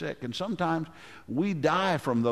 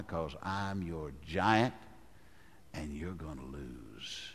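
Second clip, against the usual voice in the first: menacing giant; 9 semitones lower, 13 dB quieter, slower than usual. Pretend. Preacher voicing the taunting giant.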